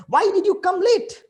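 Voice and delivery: loud, high angry shout at a latecomer. Retold or imitated. Teacher shouting at a student who came late, angry. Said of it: "I used to shout at top of my voice".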